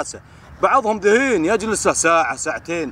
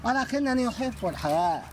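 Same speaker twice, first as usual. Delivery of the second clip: higher, stiff formal Arabic for a hedgehog; 4 semitones higher than usual. Pretend. Filmer voices the hedgehog speaking formal Arabic, higher and stiff. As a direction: give the hedgehog a solemn classical voice.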